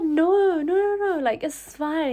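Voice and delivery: politely refusing a free bag; flustered refusal. Retold or imitated. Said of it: "I was like".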